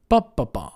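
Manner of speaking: "Ba, ba, ba" with first beat strong; BA-ba-ba, three beats; first-syllable stress pattern. Shown as a demonstration. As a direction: stress the first "Ba", let the rest fall. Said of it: "stress the first syllable".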